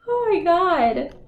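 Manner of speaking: high, loud oh my God; disbelieving burst; amazed exclaim. Real, not acted. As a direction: react like the cards just floored you. Reacts to the card spread.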